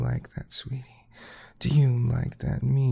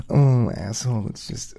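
ordinary talk

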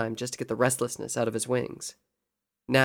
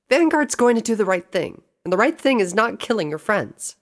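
high, loud, forceful argument; insistent young heroine; 9 semitones higher, 6 dB louder than usual. Acted. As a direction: argue stubbornly in a raised, high voice. Voices insistent Rainbow.

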